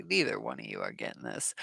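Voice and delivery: low, quiet dismissive aside; 1 muttered aside. Retold or imitated. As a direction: mutter it under your breath, low. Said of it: "I'm like".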